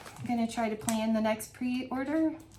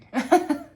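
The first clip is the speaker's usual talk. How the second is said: giggle; 6 semitones higher, 8 dB louder than usual. Real, not acted. Giggle at the glitter.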